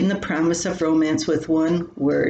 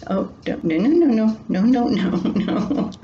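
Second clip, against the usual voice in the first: playful scolding; 4 semitones higher than usual. Real, not acted. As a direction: shoo the pet away, half laughing. Scolding Kari who wants to play.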